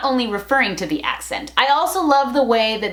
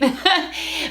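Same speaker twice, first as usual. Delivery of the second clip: short higher-pitched laugh; amused chuckle; 5 semitones higher than usual. Real, not acted. Vlogger joking about saying beach, amused chuckle. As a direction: chuckle at your own joke.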